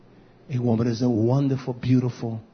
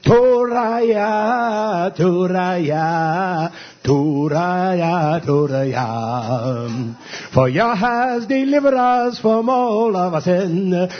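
high, loud, drawn-out preaching chant; fervent preaching; 5 semitones higher, 4 dB louder, much slower than usual. Acted. Preacher leading his congregation in drawn-out hallelujahs. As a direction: stretch every hallelujah like a revival cry.

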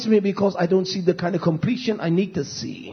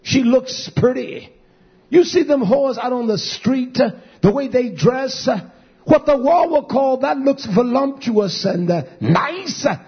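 raised high, driving sermon voice; fiery preaching; 7 semitones higher than usual. Acted. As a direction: preach it hard and high at the congregation. Preaching at full force.

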